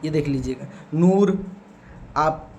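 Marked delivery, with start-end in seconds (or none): 0.9-1.5 नूर louder, with the oo stretched; emphatic long oo in नूर; drawn-out long oo. Shown as a demonstration. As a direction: pull out the vowel in नूर. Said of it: "तो खींच के पढ़ेंगे".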